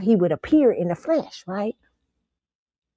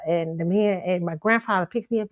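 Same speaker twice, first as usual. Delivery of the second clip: normal talking voice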